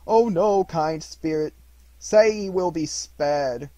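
loud, high begging, slowing at the end; desperate plea. Acted. Scrooge pleads with the spirit for Tiny Tim, loud. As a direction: plead for the boy's life. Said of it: "said Scrooge".

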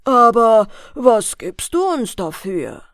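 drawn-out demand for payment; boatmen. A narrator's reading. Boatmen ask the girl for her payment, slow and loud in character. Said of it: "antworteten die Schiffer".

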